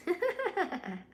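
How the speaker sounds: quick high-pitched giggle; a bright burst of laughter; laughing at the chat. Real, not acted.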